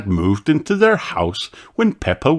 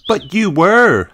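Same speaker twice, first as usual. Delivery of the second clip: high, slow little sheep voice; a child insisting; 10 semitones higher, 4 dB louder than usual. A narrator's reading. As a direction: insist like a stubborn small girl. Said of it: "insisted Susie".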